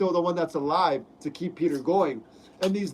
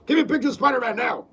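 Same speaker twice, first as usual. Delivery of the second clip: loud, fast, higher barking newspaper editor; shouting J. Jonah Jameson voice; J. Jonah Jameson impression; 5 semitones higher, 5 dB louder than usual. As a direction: yell like a frantic tabloid boss demanding photos. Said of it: "Do his voice, [name]".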